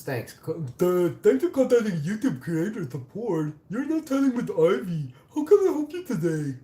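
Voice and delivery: high, loud cartoonish support-agent voice; loud, squeaky customer-service parody; mocking Google support. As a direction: mimic a chirpy chat agent in squeaky mockery. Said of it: "say it like a Google support".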